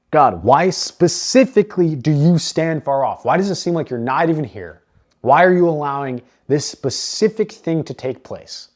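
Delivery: loud, high, impassioned plea to God; bold lament. Acted. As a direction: cry out boldly, leaning hard on each question. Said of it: "He's bringing this to God boldly".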